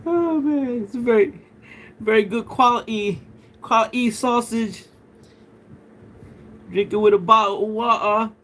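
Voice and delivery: high, hammed-up British accent; British send-up with swallowed t's, pitched high; mock British accent. Streamer kidding a UK viewer about sausage, mock British accent. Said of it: "that accent".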